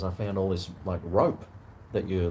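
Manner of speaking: ordinary talk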